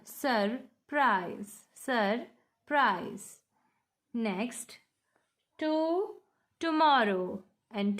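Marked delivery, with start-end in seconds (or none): 0.0-3.4 SURPRISE broken in two, sur-prise, with a pause between; split into syllables. Shown as a demonstration. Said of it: "we have two syllable".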